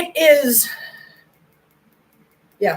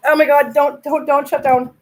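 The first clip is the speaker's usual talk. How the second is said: loud, higher begging; panicked plea; 4 semitones higher, 7 dB louder than usual. Real, not acted. Pleading with a dying phone, loud panic. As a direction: beg the phone not to die.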